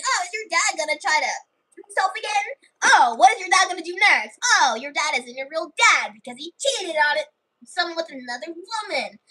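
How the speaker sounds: high, mocking schoolyard jeers; bullying taunts. School kids teasing Alia and Clover about their dad, high mocking voice. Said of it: "kids at school kept bullying".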